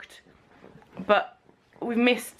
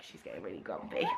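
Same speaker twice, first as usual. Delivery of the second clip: whisper; 13 semitones lower, 14 dB quieter, faster than usual. Real, not acted. Whispered aside.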